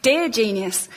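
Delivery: usual speaking voice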